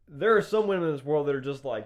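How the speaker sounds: ordinary delivery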